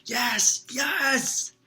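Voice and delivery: yelling. A narrator's reading. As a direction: scream with victory. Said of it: "Ron yelled".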